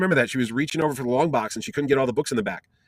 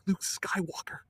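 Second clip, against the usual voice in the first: awed hush; 7 semitones higher, 11 dB quieter, slower than usual. Retold or imitated. Dad's awestruck face in photo.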